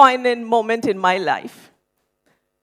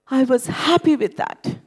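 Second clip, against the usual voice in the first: ordinary delivery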